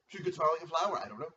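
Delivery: ordinary delivery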